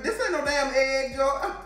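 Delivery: normal talking voice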